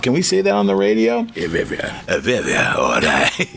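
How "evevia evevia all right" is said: put-on low Greek-accented old man; deep, put-on Greek accent; Greek elder accent. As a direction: agree like a deep-voiced Greek papu.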